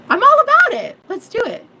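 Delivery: high, loud eager burst; excited yes. Real, not acted. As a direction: jump in with delight.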